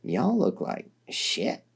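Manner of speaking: put-on slow, tired southern accent; tired southern drawl. A narrator's reading. Dirk, surprised by visitors at night, tired southern accent. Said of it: "a bit of a southern accent".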